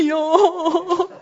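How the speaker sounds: high, laughing "Ja!"; a joyful burst of yes; eager yes. Retold or imitated. Mother answering her daughter, a high laughing yes.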